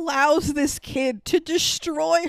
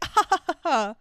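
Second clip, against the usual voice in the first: high mock laugh; put-on ha-ha-ha; indulgent mom laugh; 4 semitones higher than usual. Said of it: "And the mom's just like".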